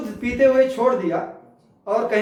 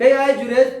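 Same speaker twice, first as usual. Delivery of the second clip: a louder, angry cry of the name; angry call; 2 semitones higher, 5 dB louder, slower than usual. Retold or imitated. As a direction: call him out in anger. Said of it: "غصے میں". (غصے میں پکار)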